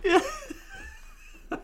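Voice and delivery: a yeah swallowed by a high laugh; real delighted high laugh. Real, not acted. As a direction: laugh your way through the yes. Delighted about funny scene.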